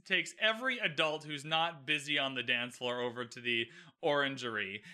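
usual speaking voice

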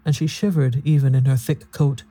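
plain reading voice

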